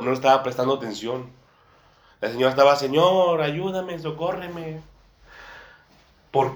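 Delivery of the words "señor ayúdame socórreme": pleading woman. Retold or imitated. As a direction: imitate a woman begging the Lord for help. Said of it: "Primero iba dando voces". (súplica imitada, más aguda y suave)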